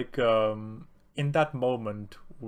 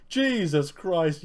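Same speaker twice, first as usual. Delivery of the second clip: exasperated swearing; 6 semitones higher, 4 dB louder, much slower than usual. Retold or imitated. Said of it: "using the Lord's name in vain".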